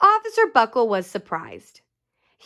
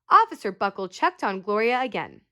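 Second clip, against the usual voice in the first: ordinary delivery, nothing marked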